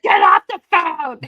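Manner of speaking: loud, high shout to get off the line; yelling. Retold or imitated. Kid yells at family on the phone line, loud shout. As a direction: yell at a sibling hogging the phone. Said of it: "I was like".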